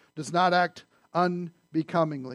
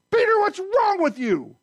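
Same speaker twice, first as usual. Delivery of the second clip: high, slow exasperated scold; hammed-up angry rebuke; mock scolding; 13 semitones higher, 4 dB louder than usual. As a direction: scold Peter like a fed-up parent. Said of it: "That's how I would have handled it".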